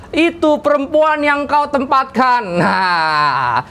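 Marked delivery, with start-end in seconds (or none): 0.1-2.4 high-pitched mock accusation; acted blaming. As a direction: pin the blame on someone else, playfully acted. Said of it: "mulai saling menyalahkan satu sama lain". (pura-pura menyalahkan)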